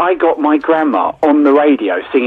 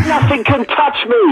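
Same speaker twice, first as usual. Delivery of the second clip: mock boastful; 12 semitones higher, slower than usual. Mock boast after winning.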